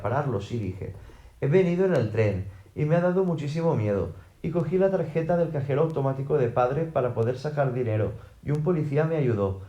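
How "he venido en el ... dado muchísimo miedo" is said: ordinary delivery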